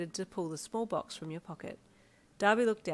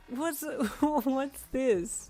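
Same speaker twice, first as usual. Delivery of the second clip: slow, laughing question; 7 semitones higher, 3 dB louder, much slower than usual. A narrator's reading. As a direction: giggle through the words. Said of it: "amid laughter".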